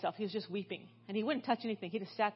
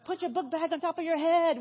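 high, louder mock order to squeeze in; mother cramming kids into a van, high urgent call; urgent mom packing kids; 8 semitones higher, 5 dB louder than usual. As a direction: hurry the kids into the tiny van. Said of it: "I was like".

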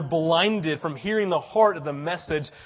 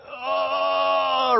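mock stunned oh; 9 semitones higher, 5 dB louder than usual. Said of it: "I'm like".

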